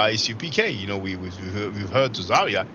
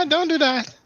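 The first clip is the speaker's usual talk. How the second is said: mock laughing protest. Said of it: "Reggie was laughing".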